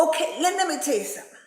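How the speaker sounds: ordinary delivery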